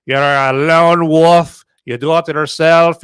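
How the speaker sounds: a slow, lightly put-on Irish accent, drawing out "wolf"; a half-hearted Irish accent, mimicking the trailer. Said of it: "my stupid attempt at, at an Irish accent".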